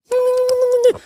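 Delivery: very high squealing laugh; the ant laughing back at Solomon. Acted. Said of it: "That's a laughing ant language".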